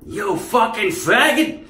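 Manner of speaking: high, slow, loud taunt; mock villain yell. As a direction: sneer it out slowly. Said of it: "I was like".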